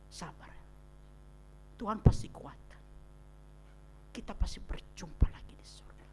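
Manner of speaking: hushed, gentle words to a mourner; soft whispered comfort. Shown as a demonstration. Preacher demonstrating short comforting words, hushed. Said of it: "Jawab pendek-pendek saja".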